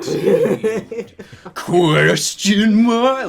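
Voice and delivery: laughing through the words; slow, cracking up reading. Real, not acted. Reading ending while laughing.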